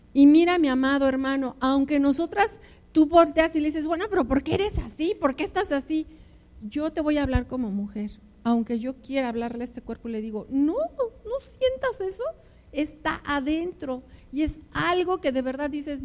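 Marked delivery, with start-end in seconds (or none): 3.9-6.1 puzzled husband, lightly voiced. 10.5-14.0 high, slow, pleading; a slow, high plea to her own body; pleading with herself. Retold or imitated.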